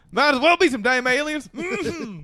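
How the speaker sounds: wild rambling cackles. Acted. Acting redneck, cackling.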